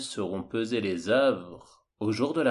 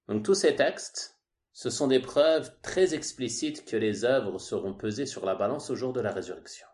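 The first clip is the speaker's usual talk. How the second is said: ordinary talk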